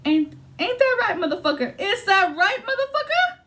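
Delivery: high, teasing jab; mocking taunt. Reader teases the querent, high and mocking.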